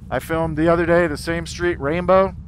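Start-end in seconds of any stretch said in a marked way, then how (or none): none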